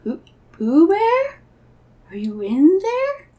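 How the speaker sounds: slow, high, loud questioning call; tentative calling out. Acted. Christopher Robin calls into Pooh's house, tentatively. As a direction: call out with each phrase rising.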